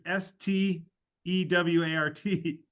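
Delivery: letters spelled out one by one, slowly. Shown as a demonstration. Spelling out how the other guy wrote Stewart, slow and deliberate. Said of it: "when he said Stewart, it was".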